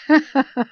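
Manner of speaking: jolly father's laugh; loud laugh. A narrator's reading. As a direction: laugh proudly.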